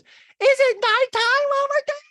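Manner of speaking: put-on very high, loud squeaky kid voice; little boy asking his mom about the time; extra cute child voice. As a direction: make it as cute as you can. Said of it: "makes his voice even cuter".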